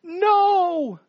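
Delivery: long, high, loud wail; imitated crying out. Preacher acts Mordecai wailing at the gates, loud anguished cry. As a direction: wail the word out, drawn long. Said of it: "he's crying and saying".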